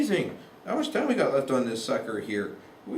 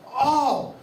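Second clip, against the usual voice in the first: sudden higher yelp of surprise; startled oh; 10 semitones higher, 3 dB louder than usual. Real, not acted. Lecturer startled mid-lecture, a sudden high oh. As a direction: caught off guard mid-rant. Sudden interruption before apologising.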